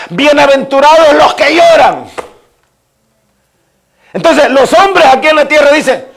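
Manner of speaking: high, loud, emphatic pulpit delivery; preaching at full force. Real, not acted. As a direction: proclaim it forcefully to the whole congregation. (prédica encendida a viva voz)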